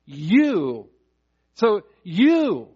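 "You" punched out alone, long and falling; emphatic you. Shown as a demonstration. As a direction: hit each "you" hard with a swooping fall. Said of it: "It's there for emphasis".

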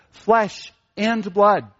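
"Flesh", pause, then a deliberate "and blood"; stress on both words. Shown as a demonstration. Preacher shows emphasis in scripture, slow stress on "Flesh" and "blood". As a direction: slowly give "Flesh" and "blood" separate, equal weight. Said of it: "we see this emphasis in this section".

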